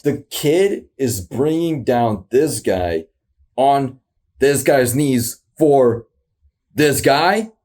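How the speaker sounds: slowly working it out; slow, puzzled. Shown as a demonstration. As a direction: drag each phrase slowly, puzzled. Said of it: "am I".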